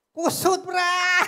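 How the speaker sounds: slow, drawn-out shouted reply; loud mock shout. Preacher shouting a cheeky reply to the congregation, slow shout. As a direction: bellow it slowly as a cheeky punchline.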